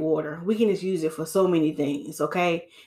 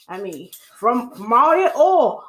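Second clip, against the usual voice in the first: worked up; 2 semitones higher, 3 dB louder than usual. Real, not acted. Stylist gets worked up about clients' oily hair, excited. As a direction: let it burst out, slowing on all that oil. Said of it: "Let me calm down".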